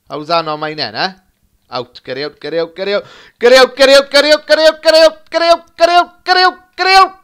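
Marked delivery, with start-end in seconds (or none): 1.7-7.2 rising from plain to a high, loud yell; building frantic shout. Real, not acted. Gamer screaming his striker through on goal. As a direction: chant it faster and higher as he runs. Urging player to break away.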